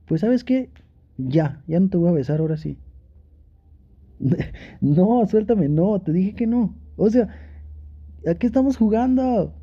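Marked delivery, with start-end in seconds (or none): 0.1-0.7 higher, teasing mock complaint; a lover's mock sulk; playful pouty protest. 4.8-7.3 teasing no, no, no; playful refusal. Acted. Pulling away from partner's grip.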